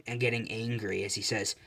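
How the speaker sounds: ordinary delivery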